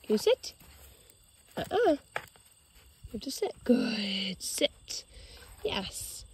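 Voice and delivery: calm him with a hush; soft commands. Real, not acted. Quiet sit command to dog.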